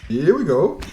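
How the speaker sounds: rising hype call for the start; excited. Real, not acted. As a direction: kick off the match with energy. Game starts, caster hypes.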